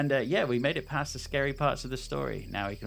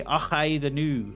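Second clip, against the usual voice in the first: put-on "Och, aye, the new" higher and louder in a Scottish accent; hammed-up Scots, higher and louder; mock Scottish accent; 5 semitones higher, 7 dB louder, slower than usual. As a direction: imitate grandad's Scottish catchphrase. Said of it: "He, he even used to say".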